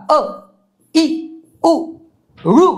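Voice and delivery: अ, ई, उ, ऋ each as a very brief, separate sound; short vowels. Shown as a demonstration. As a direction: cut अ, ई, उ, ऋ short, with pauses between. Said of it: "वेळ कमी लागणाऱ्या स्वराला ऱ्हस्व". (अ, ई, उ, ऋ हे ऱ्हस्व, तुटक उच्चारात)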